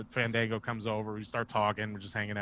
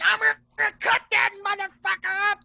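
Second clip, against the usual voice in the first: mock crazed threat; 6 dB louder than usual. Sparta with the samurai sword.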